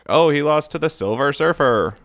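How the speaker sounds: normal talking voice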